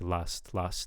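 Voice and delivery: "last" said like "lust"; mispronounced vowel. Shown as a demonstration. Reviewer copying the learner's last, sounding like lust. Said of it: "kind of hearing, like".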